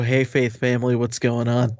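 haggard voice. Acted. Co-host imagines the clip's speaker greeting his followers, lightly haggard. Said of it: "hear him in a haggard voice".

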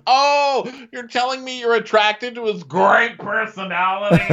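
high, loud sarcastic sneer; sarcastic mockery. Drunk woman mocking the other wife, high sneer. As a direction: drip with disbelief.

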